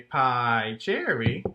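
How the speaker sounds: PIE CHERRY in slow, even beats; rhythmic demonstration. Shown as a demonstration. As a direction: stretch "pie", then two beats on "cherry". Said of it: "that might sound more like".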